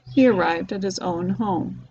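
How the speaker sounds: ordinary delivery, nothing marked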